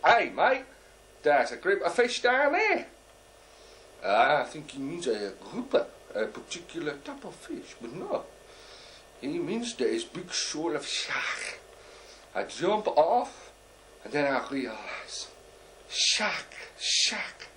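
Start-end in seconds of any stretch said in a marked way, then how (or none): 0.1-2.9 high, louder mock cockney call. A cockney tells the snorkeler about fish, mock cockney shout. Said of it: "one of these cockneys says". 15.9-17.5 high, airy cries of shark; mock alarm. Snorkeler realizes there are sharks below, mock alarm.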